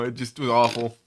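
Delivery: ordinary delivery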